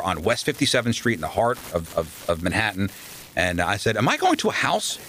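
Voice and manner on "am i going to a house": high disbelieving question, a touch mocking; half-amused incredulity at living at the station; incredulous. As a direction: ask it like you can't believe your ears. Disbelief, pitch jumps.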